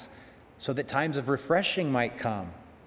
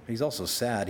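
ordinary talk, nothing marked

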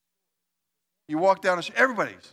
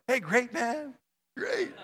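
fake cheerful; 8 semitones higher, 4 dB quieter, slower than usual. Fake cheer greeting after argument.

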